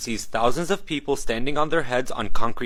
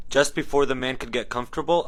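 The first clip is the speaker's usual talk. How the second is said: normal talking voice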